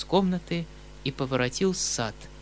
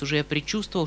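ordinary delivery

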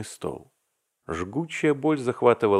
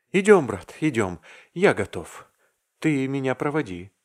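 feverish eagerness; 5 semitones higher than usual. A narrator's reading. Vasya eager to go.

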